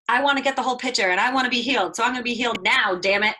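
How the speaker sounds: fast, loud impatient whining; impatient demand. Retold or imitated. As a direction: demand it right now, loudly. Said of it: "a lot of people think that".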